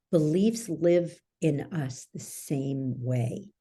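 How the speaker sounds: normal talking voice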